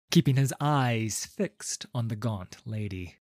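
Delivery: ordinary delivery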